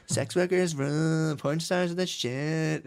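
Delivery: mock slogan. Mocking supporters' slogan.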